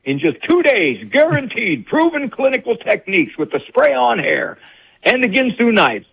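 high, hammy infomercial announcer; infomercial pitch. Retold or imitated. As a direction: sell it like a cheesy TV ad. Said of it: "sounds like a infomercial".

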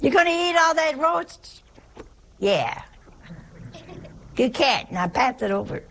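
mock squabble, opening high; Italian table squabble. Relatives demanding the roast at dinner, mock squabbling. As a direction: imitate blunt family bickering over food. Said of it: "that's how they speak".

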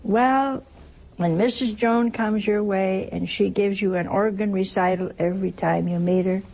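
ordinary talk

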